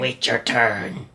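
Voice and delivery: slow creepy fishman voice; fish man. Acted. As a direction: croak it out like a fishman. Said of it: "Oh, I need a fishman voice now".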